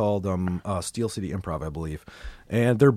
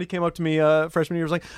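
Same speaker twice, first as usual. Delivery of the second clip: normal talking voice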